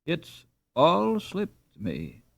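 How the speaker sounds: slow, groping words; speaking very slowly. A narrator's reading. Ralph to his wife, slowly recalling the lost name. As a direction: drag each word out, searching memory. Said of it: "speaking very slowly".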